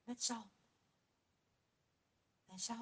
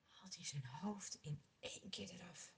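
whispered, much softer; hushed whisper; 6 semitones lower, 18 dB quieter than usual. A narrator's reading. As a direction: drop to a whisper for the grim part. Gruwelijk moment zacht verteld. (zacht fluisterend)

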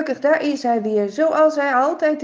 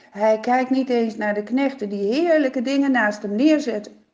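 ordinary delivery

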